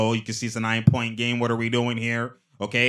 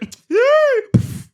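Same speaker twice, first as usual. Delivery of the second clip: high shrieking laugh; 9 dB louder than usual. Real, not acted. Reacting to replay.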